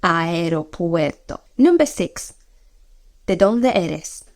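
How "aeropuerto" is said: aeropuerto said slowly, each syllable clear; slow clear pronunciation. Shown as a demonstration. Teacher showing how aeropuerto is pronounced, slowly. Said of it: "Se pronuncia".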